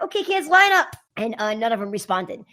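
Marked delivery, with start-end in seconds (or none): 0.0-0.9 higher, louder call to a class; calling out to kids. Retold or imitated. Instructor calling a gym full of small kids, high loud call. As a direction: call the children to line up.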